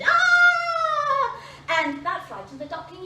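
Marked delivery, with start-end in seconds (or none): 0.0-1.4 loud, high shrieking scream; wife's high, loud startled scream. Acted. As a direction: shriek in fright at the bird. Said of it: "she screamed".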